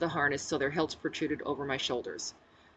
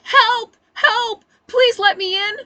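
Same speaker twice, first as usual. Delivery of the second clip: high, loud desperate screams; screaming for help; 14 dB louder, slower than usual. Acted. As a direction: scream it, pounding the door. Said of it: "I screamed".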